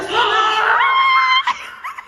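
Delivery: delighted laughing shriek. Real, not acted. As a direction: let out a laughing scream. Reacting to the chilly room.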